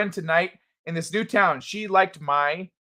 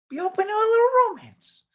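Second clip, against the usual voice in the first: very high falsetto coo; playful coaxing; 14 semitones higher, faster than usual. Acted. Streamer coaxes Courtney toward romance in a high mock-romantic voice. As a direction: sing it up high, teasingly.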